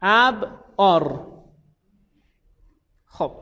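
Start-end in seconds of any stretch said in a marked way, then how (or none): 0.0-1.3 ابعار stretched very slowly, every sound clear; drawn-out model word. Shown as a demonstration. Teacher modelling ابعار to students, slow and drawn out. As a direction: spell out ابعار slowly for the class. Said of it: "آفرین".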